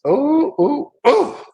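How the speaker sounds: high startled oh oh; surprised oh. Real, not acted. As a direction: react in alarm. Reacting to bad numbers.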